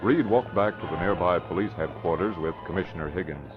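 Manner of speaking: ordinary talk, nothing marked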